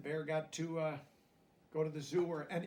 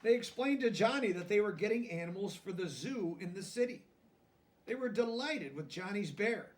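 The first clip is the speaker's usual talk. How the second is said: gentle storybook reading, a touch higher; lively picture-book narration; reading to children; 5 semitones higher, 7 dB louder than usual. A narrator's reading. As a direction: read it like a bedtime story to kids.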